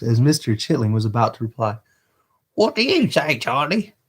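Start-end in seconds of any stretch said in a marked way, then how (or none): 2.5-3.9 high Dodger voice. Acted. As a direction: drag it out, high and scornful.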